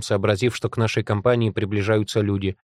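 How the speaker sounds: usual speaking voice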